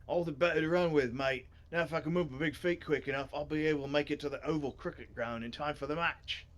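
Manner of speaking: hearty put-on Australian character voice; Australian-sounding Griff. Reader as Griff answering about his big feet, Australian accent. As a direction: banter like a sporty Aussie mate.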